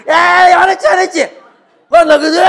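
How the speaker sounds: put-on loud, high-pitched childish taunting; squabbling young brothers. Young sons fighting over who got angry, loud mock squabble. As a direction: act out kids needling and hotly denying it. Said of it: "우리 아들들이 하는 짓입니다".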